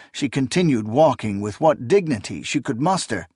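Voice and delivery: ordinary delivery, nothing marked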